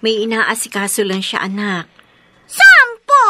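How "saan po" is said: put-on high, loud child's question; eager child. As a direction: ask eagerly like a little kid. Child eagerly asks after father. (sabik na tanong ng bata)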